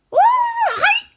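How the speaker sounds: very high, indignant shriek; old woman yelling. A narrator's reading. Grandma throwing George's words back, yelling in outrage. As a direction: screech it back in disbelief. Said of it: "she yelled".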